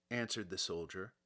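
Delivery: ordinary delivery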